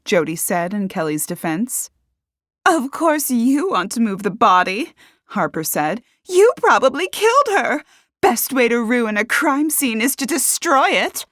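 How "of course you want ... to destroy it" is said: high, loud accusation; accusing. A narrator's reading. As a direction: go on the attack against a suspect. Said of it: "Harper accused".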